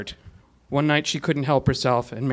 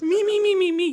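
very high, rapid squeaky me-me-me; high-pitched squeal. A narrator's reading. Gilligan squealing as he swings the skipper, high-pitched. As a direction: imitate tiny repeated squeals. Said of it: "a high-pitched".

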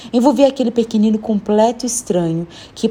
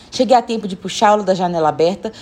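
ordinary delivery, nothing marked

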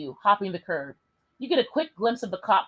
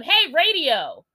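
slow, loud shout; 7 semitones higher, 6 dB louder, much slower than usual. Acted. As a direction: shout at the cabbie. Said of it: "you shout at him".

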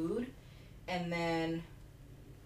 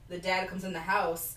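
ordinary talk, nothing marked

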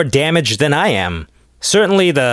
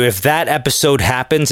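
ordinary talk

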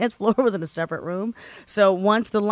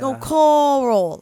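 slowly drawn-out Baltimore "Coral"; slow Baltimore Carl; 7 semitones higher, 7 dB louder, much slower than usual. Retold or imitated. The party worker slowly repeating his name in Baltimore accent. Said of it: "I'm like".

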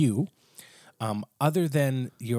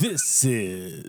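radio voice; 2 semitones lower than usual. Acted. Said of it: "I wanted to put my radio voice on".

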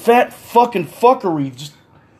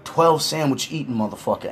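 ordinary delivery

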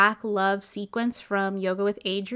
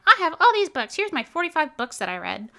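mock bragging; 6 semitones higher, 4 dB louder than usual. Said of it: "and they're like".

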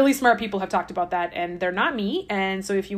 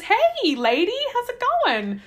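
high, drawn-out casual hello; hammed-up friendly hail in a high pitch; chatty snake greeting; 12 semitones higher, 3 dB louder than usual. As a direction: greet her in a high singsong. Voicing snake's greeting to the woman.

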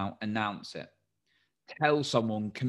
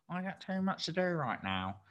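funny voice; 8 semitones higher, 4 dB quieter than usual. Retold or imitated. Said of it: "in my funny voice".

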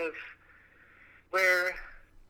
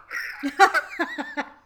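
loud high laugh; 13 semitones higher, 9 dB louder than usual. Real, not acted. Laughs at own joke.

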